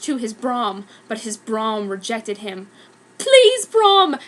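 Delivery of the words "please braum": high, loud pleading shout; shouted plea. Acted. Harry begging his broom to carry him. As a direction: beg the broom at full voice. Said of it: "Harry shouted".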